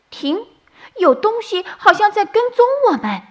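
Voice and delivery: high, quick little rabbit voice; a tiny rabbit sensing danger; alarmed bunny. A narrator's reading. (小兔紧张的尖细声音)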